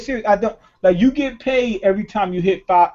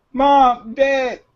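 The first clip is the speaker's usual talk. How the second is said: put-on higher, pleading kid asking mom and dad for cash; mock begging parents; 5 semitones higher than usual. A son hamming up a plea to rich parents, high and pleading. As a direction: beg your parents like a spoiled kid, lightly.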